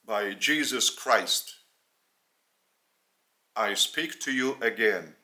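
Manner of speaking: normal talking voice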